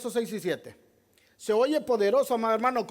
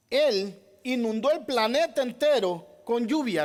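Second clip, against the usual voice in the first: ordinary delivery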